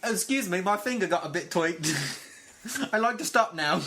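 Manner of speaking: hammed-up Sin Cara accent; jokey Sin Cara impression, accent laid on thick. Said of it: "when did he get that accent".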